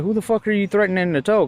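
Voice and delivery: fast, high, indignant challenge rising at the end; retold cussing out. Driver to cop threatening a tow, retold indignation. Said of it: "I ended up cussing this one out".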